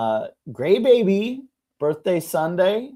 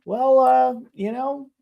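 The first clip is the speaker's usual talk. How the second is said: high-pitched, polite sympathy; awkwardly nice; 11 semitones higher than usual. Retold or imitated. Girlfriend consoles yelling neighbor, high awkward niceness. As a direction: imitate the girlfriend trying to be nice. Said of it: "the nicest thing that she could have".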